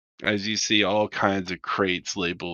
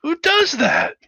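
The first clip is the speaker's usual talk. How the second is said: very high, louder disbelief; incredulous shout; 5 dB louder than usual. Acted. Hangar crewman can't believe the raiders blew their ship, high cry. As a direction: fling the question at the room. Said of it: "hangar bay go".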